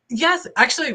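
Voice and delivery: normal talking voice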